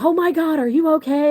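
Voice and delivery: high, worried "are you okay"; alarmed boyfriend. Retold or imitated. Boyfriend jumping down to check on her, alarmed. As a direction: voice Cody rushing over in alarm.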